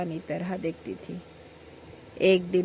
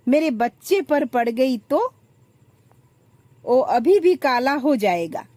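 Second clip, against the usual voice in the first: angry shout; 6 semitones higher, 7 dB louder than usual. Acted. Elder daughter-in-law yelling at younger one, high furious shout. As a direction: scream her out of the room in contempt. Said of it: "चिल्लाकर बोलती है".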